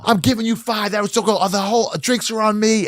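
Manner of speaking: very high-pitched imitation of an elated winner; overjoyed generosity. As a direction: announce the gift in a giddy high voice. Winner shares prize, retold.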